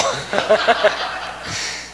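airy burst of laughter; a soft unvoiced laugh; breathy laugh. Real, not acted. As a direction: laugh out at the joke. Laughing at own story.

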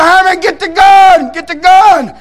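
high, loud, stretched-out yell; slow drawn-out scream for help; retold frantic shout to a partner; desperate holler. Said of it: "And I, and I hollered".

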